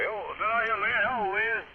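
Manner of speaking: ordinary talk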